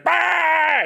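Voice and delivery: high, sudden mock "Bah!" yelp; Rabbids yell. Imitating the Raving Rabbids for chat, high mock yelp. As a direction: bark out a silly Rabbid cry. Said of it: "the Raven Rabbits going".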